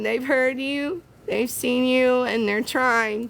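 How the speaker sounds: ordinary delivery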